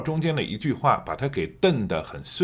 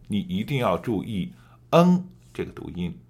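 usual speaking voice

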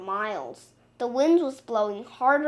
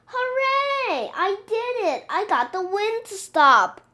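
excited; 9 semitones higher, 5 dB louder than usual. Acted. Mouse cheers at his success.

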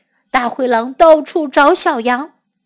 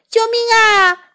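scared yelp; 8 semitones higher than usual. Acted. Wolf flees in fright.

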